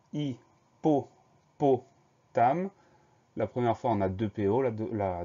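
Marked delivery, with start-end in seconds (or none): 0.1-2.7 "hippopotame" very slowly, a clear pause after each syllable. Shown as a demonstration. Teacher recapping a spelling, "hippopotame" syllable by syllable. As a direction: split "hippopotame" into hi, po, po, tame. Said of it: "deuxième syllabe".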